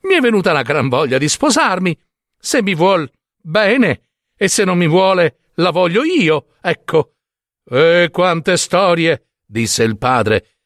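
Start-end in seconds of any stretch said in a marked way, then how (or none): 0.0-7.1 higher, headstrong simpleton; excitable son pressing his case; eager insistence. Acted. 7.7-9.3 slow, higher exasperated scoff; drawn-out impatient dismissal; dismissive father. Acted. Father dismisses his son's demand.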